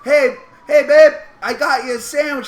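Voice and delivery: proud dad showing off his sandwich; eager dad. Retold or imitated.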